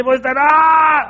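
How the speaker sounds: high, veins-bulging strained cry; grito de esfuerzo. Acted. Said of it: "las venas así saltadas en la frente". (grito agudo con las venas saltadas)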